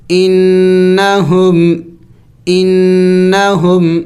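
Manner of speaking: انہم slow with the نّ hummed; drawn-out انہم, nasal; انہم recited slowly, nasal نّ; nasal ghunna. Shown as a demonstration. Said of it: "ناک میں آواز پیدا کرنا".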